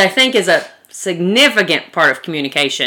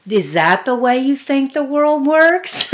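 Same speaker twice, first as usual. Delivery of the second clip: slow, higher pitch climbing to the end; mock incredulous question; 7 semitones higher, slower than usual. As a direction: draw it out as a pointed question. Mock reply to mansplaining husband.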